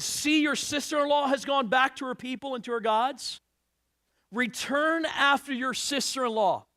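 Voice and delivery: high, loud declamatory Bible reading; scripture reading. Acted. Reads scripture aloud with force.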